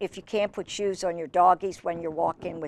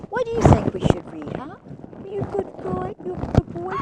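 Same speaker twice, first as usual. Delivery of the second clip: high sweet voice for the dog; 11 semitones higher than usual. Real, not acted. Owner cooing at her dog in a high sweet baby voice.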